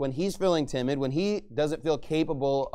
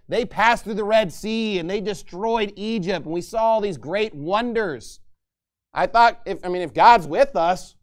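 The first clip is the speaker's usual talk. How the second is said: raised-pitch griping with some attitude; frustrated attitude; 4 semitones higher, 6 dB louder than usual. Acted. Gideon complains that God's miracles are missing, frustrated with attitude. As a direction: voice a mildly exasperated challenge, drawing out the complaints. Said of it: "he has a little bit of an attitude".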